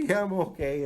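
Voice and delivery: high sheepish answer; mock meek reply. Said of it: "answering wife".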